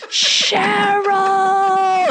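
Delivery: high, drawn-out mock scream; screaming at someone. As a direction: imitate a man wailing a name across water. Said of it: "he's screaming at the top of his lungs".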